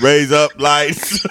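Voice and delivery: drawn-out words through a laugh; slow, laughing demo. Shown as a demonstration. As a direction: stretch each word, half laughing.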